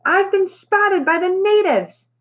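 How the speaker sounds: very high, slow shrieking bird; high drawn-out parrot screech. A narrator's reading. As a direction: shriek it in mock alarm. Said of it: "she shrieked".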